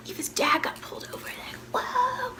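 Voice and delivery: hushed gossip whisper; awed whisper. Retold or imitated. Classmates whispering in awe about Eva's dad.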